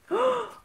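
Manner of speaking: sharp high gasp of delight; a real startled gasp; excited gasp. Real, not acted. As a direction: gasp as if the gift stuns you. Opening the watch box.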